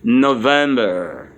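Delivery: November as slow English no-VEM-ber, low and falling; English pronunciation. Shown as a demonstration. Teacher answers her own question, November in slow, low English. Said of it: "November is what?".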